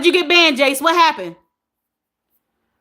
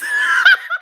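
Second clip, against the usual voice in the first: short, very high burst of laughing. Real, not acted. Host keeping thoughts to herself, high laugh. Cracking up at chat.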